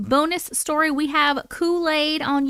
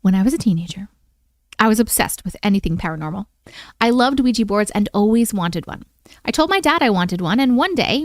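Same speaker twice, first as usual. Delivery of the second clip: louder, steady storytelling read; story narration; 3 semitones lower, 5 dB louder than usual. Acted. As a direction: read it like a campfire tale. Reads listener's scary story.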